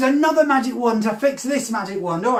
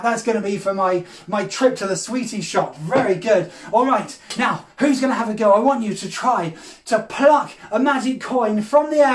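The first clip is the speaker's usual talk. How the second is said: normal talking voice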